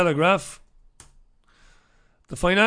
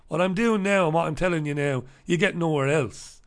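ordinary talk

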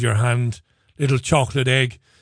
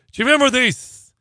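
excited question; 10 semitones higher, 3 dB louder than usual. Real, not acted. Host recalling old chocolate ad.

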